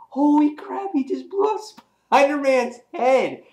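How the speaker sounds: high-pitched amazed retelling; floored comic fan; shocked reaction. Retold or imitated. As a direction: exclaim it high, in disbelief.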